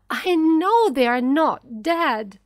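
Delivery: loud, high-pitched insistence; screamed denial. A narrator's reading. Mother refusing to accept her children's death, frantic shout. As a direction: insist at the top of your voice. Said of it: "screamed Missus Dawson".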